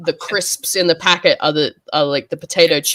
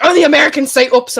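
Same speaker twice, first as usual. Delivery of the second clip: louder, higher, fast scold; mum scolding; 8 semitones higher, 5 dB louder, faster than usual. Retold or imitated. Mum corrects her daughter's oops, mock scolding. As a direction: scoff at the American word. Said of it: "she's like".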